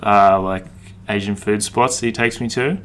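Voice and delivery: ordinary delivery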